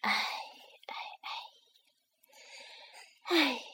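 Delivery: acted weary sighing, spaced out; sparrow's sigh. Sparrow can't explain it's a dream, sighing. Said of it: "麻雀叹口气".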